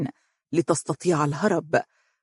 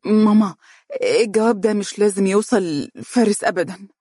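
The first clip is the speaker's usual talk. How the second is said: flustered; 4 semitones higher than usual. Acted. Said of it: "تلعثمت مهرة".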